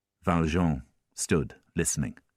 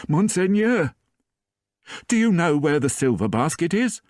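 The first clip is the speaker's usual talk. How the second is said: high, loud, anxious housekeeper's cry; alarmed housekeeper; 11 semitones higher, 4 dB louder than usual. A narrator's reading. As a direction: burst in alarmed, voice pitched high. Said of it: "with a look of alarm on her face".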